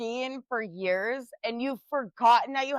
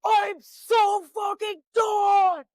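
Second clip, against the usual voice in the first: loud, slow, drawn-out furious yell, much higher; angry yell; 7 semitones higher, 6 dB louder, much slower than usual. Retold or imitated. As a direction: bellow it out, fed up and done. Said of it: "when I yell, I sound like".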